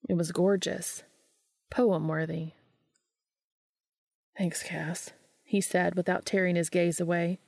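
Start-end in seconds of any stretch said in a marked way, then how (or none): 4.4-5.1 soft, breathy whisper of thanks; hushed grateful whisper. A narrator's reading. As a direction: murmur it, eyes on the view. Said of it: "unvoiced whisper".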